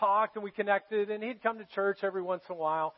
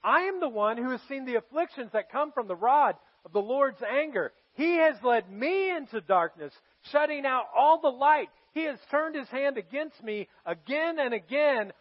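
scripture reading; 5 semitones higher, 5 dB louder than usual. Acted. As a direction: read it like a grieving prophet. Reads scripture at full force.

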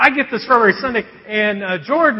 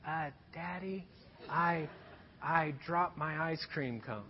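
put-on tiny, much softer guilty child voice; little mouse voice; 4 semitones lower, 19 dB quieter than usual. Daughter confessing the dropped cone in a tiny soft voice. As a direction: peep it out like a scared little girl. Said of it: "this little mouse voice".

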